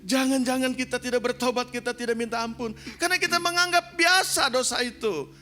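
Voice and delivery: high, loud, driving sermon cry; full-force preaching. Real, not acted. Preacher warning the church about unrepented sin, impassioned shout.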